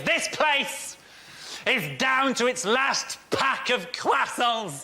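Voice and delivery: high, dramatic reporter slowing for suspense; tense news reporter. Retold or imitated. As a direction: ramp up the tension like a reporter on location. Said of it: "in an attempt to ramp up the tension".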